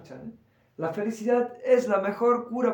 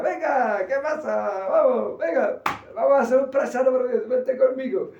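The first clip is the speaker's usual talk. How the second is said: high, loud, playful teasing call; jolly teacher coaxing a sad student; cheerful guru; 5 semitones higher, 6 dB louder than usual. Retold or imitated.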